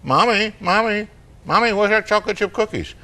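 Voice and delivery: put-on high, pleading little-kid voice; four-year-old. Four-year-old seeks out mom for cookies, high child voice. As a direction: imitate a small child pestering for attention. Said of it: "He goes and seeks out his mom".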